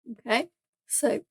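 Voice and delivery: talking through a hiccup. Real, not acted. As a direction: hiccups mid-sentence. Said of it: "I've got hiccups there".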